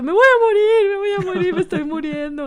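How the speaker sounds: high, loud mock-panicked cry; hammed-up dying panic; panicked retelling. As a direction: wail it in exaggerated alarm, easing off as it goes. Retells childhood panic at bleeding.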